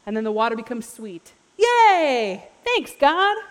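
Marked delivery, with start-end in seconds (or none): 1.5-3.4 high, loud, hammed-up delight; mock cheering. Israelites thanking God for sweet water, mock-gleeful cheer. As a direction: cheer with exaggerated glee.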